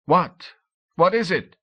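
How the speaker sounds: puzzled men calling out from the shore; shouting. A narrator's reading. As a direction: shout the question across the water. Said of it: "They were shouting".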